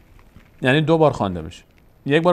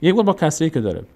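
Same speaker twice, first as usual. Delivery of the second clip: ordinary delivery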